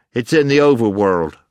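breathless, loud and strained; short of breath. A narrator's reading. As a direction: force it out short of air. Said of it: "struggling a little to get his breath".

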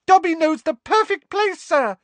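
very high, loud, delighted squeak; happy elf. A narrator's reading. Elf delighted to know the perfect place, high and excited. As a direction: burst out with the good news. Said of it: "he said happily".

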